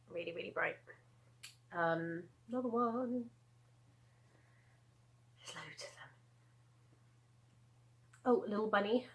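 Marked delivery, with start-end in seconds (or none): 5.4-6.1 hushed whisper aside; breathy whispered remark. Real, not acted. Aside while sorting hats.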